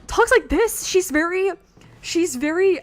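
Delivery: loud, high, energetic voice; Redacted. Shown as a demonstration. Streamer demonstrating Redacted, energetic and very expressive. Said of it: "energetic and has a lot of expression to her voice".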